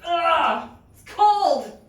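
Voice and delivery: loud, high gasp at cold water; shocked by cold. Real, not acted. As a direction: yelp from the icy water.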